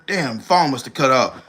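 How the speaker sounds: ordinary talk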